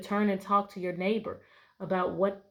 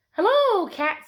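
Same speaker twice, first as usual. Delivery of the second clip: cheerful duck greeting; 11 semitones higher, 10 dB louder, slower than usual. Acted. Duck greets cat in story.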